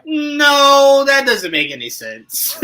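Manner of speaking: acted-out indignant disbelief; incredulous protest. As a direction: protest in disbelief, long high NO settling down. Acts out fans' disbelieving reaction.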